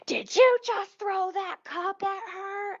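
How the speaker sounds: high, drawn-out mock outrage; outraged accusation. Worker confronts customer over thrown cup, mock outrage. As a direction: imitate an accuser, slow and high-pitched.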